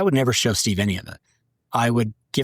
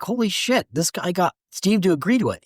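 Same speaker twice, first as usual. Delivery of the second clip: imitating amazed staff; 9 semitones higher than usual. Staff amazed at the Steve approval.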